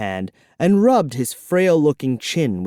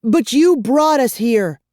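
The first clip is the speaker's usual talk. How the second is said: put-on higher, louder girl's protest; angry girl protesting; 11 semitones higher, 4 dB louder than usual. A narrator's reading. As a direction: protest angrily as a young girl. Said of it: "Kara protested angrily".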